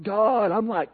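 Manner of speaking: mock Missouri hillbilly complaint to God. Preacher voicing Elijah complaining to God, light Missouri hillbilly twang. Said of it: "I got this Missouri hillbilly thing going on".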